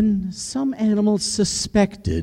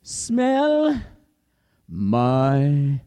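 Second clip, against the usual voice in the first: lion king; 8 semitones higher, slower than usual. Acted. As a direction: growl the test like a hungry king. Lion calls the rabbit.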